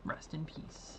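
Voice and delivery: breathy whispered farewell; whispering. Pretend. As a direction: whisper it like a eulogy. Whispered mock eulogy.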